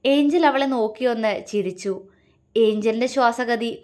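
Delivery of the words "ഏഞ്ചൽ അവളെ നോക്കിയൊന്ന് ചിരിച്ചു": ordinary delivery, nothing marked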